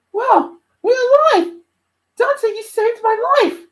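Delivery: high, loud, drawn-out cry of relief; relieved. Acted. Luna to Dante after escaping Phantom, relieved and elated. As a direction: exclaim it high, slowly and loudly. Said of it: "out of breath and relieved".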